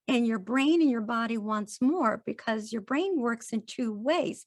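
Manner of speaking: ordinary talk, nothing marked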